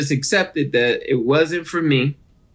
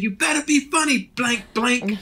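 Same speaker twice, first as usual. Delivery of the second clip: higher-pitched mock heckler voice; heckler's warning; 8 semitones higher than usual. Audience guy warning the nervous new comic, mock threat. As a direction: imitate a man warning from the crowd.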